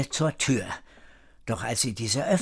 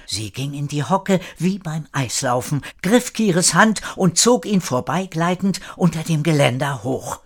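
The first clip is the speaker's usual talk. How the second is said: ordinary delivery, nothing marked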